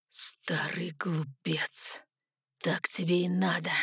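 slow, satisfied whisper; whispered contempt from a pleased intruder; spat out, gloating. A narrator's reading. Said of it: "выплюнул довольно пришелец".

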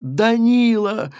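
wife screaming her husband's name; desperate cry. A narrator's reading. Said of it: "закричала в отчаянии". (отчаянный крик жены)

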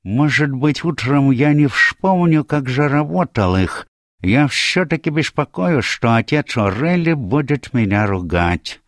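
high-pitched, anxious-to-please character voice; hopeful Atlas. A narrator's reading. Atlas confiding his worry to the detective, hopeful. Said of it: "Атлас с надеждой произнёс".

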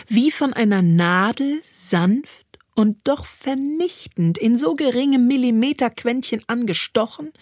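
normal talking voice

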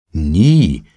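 loud, eager denial; eager cry. A narrator's reading. Trotty protests to the bell spirit, crying out eagerly. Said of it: "schrie Trotty eifrig".

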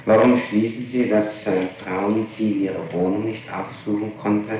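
usual speaking voice

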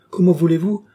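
high, loud impassioned plea; ardent, actorly protest; fiery tirade. A narrator's reading. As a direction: declaim with theatrical fire. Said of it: "débitée avec un feu".

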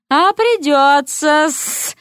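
slow, high, sly snake-woman drawl with hissed endings; hissing snake lady. Acted. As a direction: purr it out like a scheming serpent. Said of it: "отозвалась Нагина".

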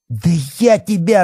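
high-pitched outburst of rage; furious. A narrator's reading. Policeman lunges at the cheeky boy, furious. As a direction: burst out as if about to attack. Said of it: "разъярился Бахметьев".

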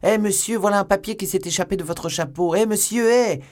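high, loud calls after someone; urgent shouting. A narrator's reading. Stable boy calling the stranger back, shouting. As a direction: holler to stop a man leaving. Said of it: "s'écria un garçon d'écurie courant après".

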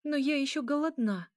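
high, whimpering complaint; whining. A narrator's reading. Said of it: "захныкала Эля".

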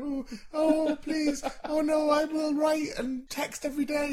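high whiny plea; mock pleading. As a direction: beg in a needy voice. Mimicking pleading friend.

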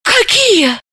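high, breathless frightened question; испуганно; frightened gasp. A narrator's reading. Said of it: "испуганно выдохнула".

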